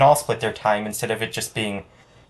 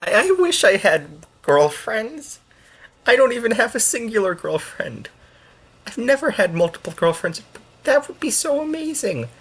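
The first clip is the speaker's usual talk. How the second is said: mock whiny wish; 12 semitones higher than usual. Streamer whining to chat about lacking girlfriends, high mock pining. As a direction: squeak it out like a lovesick kid.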